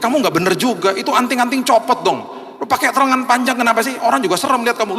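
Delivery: high-pitched retold telling-off; scolding. Pastor rebukes friend about earrings and clothes, scolding. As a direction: scold a friend, pitched well above normal. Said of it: "saya juga tegur dia".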